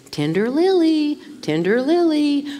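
husband gently reminding his wife, in a lightly voiced kind tone; kindly husband. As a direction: lift the voice and coax it kindly. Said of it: "And so kindly he would say".

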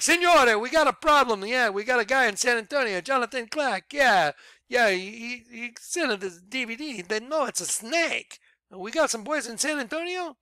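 a hint of a panicky messenger bursting in; Vatican messenger. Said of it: "and he's like".

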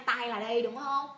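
ordinary talk, nothing marked

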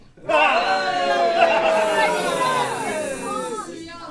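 loud villain cackle; evil cackle. Acted.

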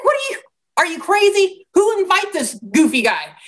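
high-pitched, incredulous outrage; stirred-up anger. Acted. Mourners challenging the goofy guy, acted angry disbelief. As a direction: play the affronted mourner, voice jumping up. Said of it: "getting stirred up with anger".

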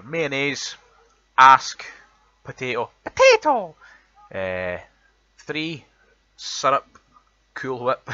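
reads each word out slowly and loudly in a broad Edinburgh accent; Edinburgh accent word list. Shown as a demonstration. Reading a word list to show his Edinburgh accent, loud and deliberate. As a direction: lays every word out clearly, showing off the Edinburgh sound. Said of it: "the words that we've got".

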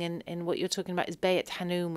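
ordinary talk

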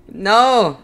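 loud high panicked no; shouted no. Real, not acted. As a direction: yell it in alarm. Reacting to game event.

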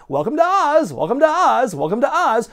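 high, repetitive mock wizard voice; high-pitched imitation of a masked wizard; wizard's greeting. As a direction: chant it high like a hammy wizard welcoming visitors. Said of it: "He just keeps saying".

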